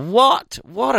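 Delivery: high, rising disbelief; astonished. Real, not acted. Host hearing the hospital figures, astonished. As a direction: blurt it out in astonishment. Shocked at the statistic.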